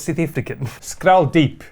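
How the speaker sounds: badly faked South African accent; pretend South African accent. Host jokingly claiming a secret origin, pretend South African accent. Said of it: "that was actually a pretend South African accent".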